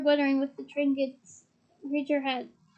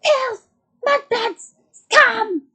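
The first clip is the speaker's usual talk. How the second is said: shrill screaming insults, much higher and louder; screaming portrait; 8 semitones higher, 10 dB louder, much slower than usual. A narrator's reading. Portrait of Sirius's mother screaming after them. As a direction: scream abuse after the fleeing boys. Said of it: "she screamed after them".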